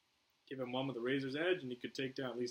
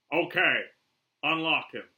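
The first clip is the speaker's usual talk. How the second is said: loud, higher commanding call; firm command; 6 semitones higher, 13 dB louder, slower than usual. Acted. Flag orders his men to unchain Croc, firm loud command. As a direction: order it out loudly.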